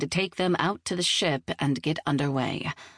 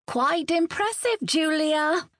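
high, sing-out call; 12 semitones higher, slower than usual. A narrator's reading. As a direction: call across to a friend in a much higher voice. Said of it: "Genevieve called from nearby".

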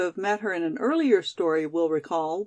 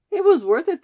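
gleeful burst; 9 semitones higher, 7 dB louder than usual. Acted. Excited relieved friend.

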